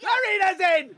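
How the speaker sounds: high-pitched delighted verdict; excited cheer. Pretend. Host casting the friend after the accent audition, excited cheer. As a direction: cry out that the audition is won.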